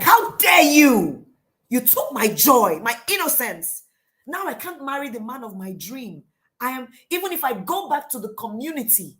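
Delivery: theatrical outraged taunts; taunting. Acted. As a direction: berate her dramatically, rushing between loud peaks. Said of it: "She begins to taunt her".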